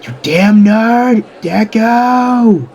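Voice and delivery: high, loud, drawn-out fury; enraged yell. Acted. Bakugo sees his cake on the floor, enraged yell. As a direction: stretch each word into an angry yell.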